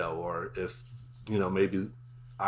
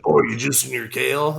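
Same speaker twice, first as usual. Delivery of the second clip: mocking; 4 semitones higher, 9 dB louder than usual. Mocking kale fans.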